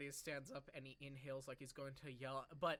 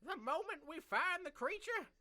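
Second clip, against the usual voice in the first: put-on old lady voice; 8 semitones higher, 4 dB louder than usual. GM voicing the old Harengon.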